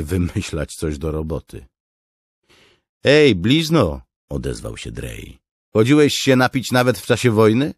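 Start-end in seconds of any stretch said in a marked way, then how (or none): 3.0-4.0 high, loud hail to a comrade; calling out. A narrator's reading. Drej calls out to his comrade. 5.7-7.7 loud, high-pitched soldier's question; called-out question. A narrator's reading. Drej calls a question to comrade.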